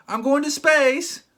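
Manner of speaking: mock dumb logic. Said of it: "That's like me saying".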